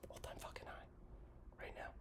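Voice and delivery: slow, hushed aside; whispered aside. Real, not acted. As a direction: drop to a secretive whisper.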